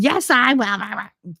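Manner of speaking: put-on slow, stubborn child's reply; stubborn kid. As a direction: drag it out like a stubborn boy.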